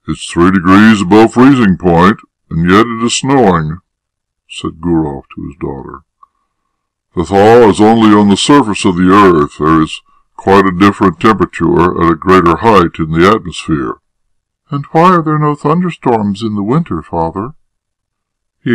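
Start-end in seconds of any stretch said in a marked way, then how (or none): 0.1-3.8 slightly louder, explanatory father voice; lightly voiced father. Father to his daughter on the way to school, explaining. 7.1-14.0 slightly louder, explanatory father voice; lightly voiced father. Father to his daughter on the way to school, explaining. 14.7-17.5 high-pitched questioning daughter; curious daughter. Acted. Daughter asking her father about the weather, curious.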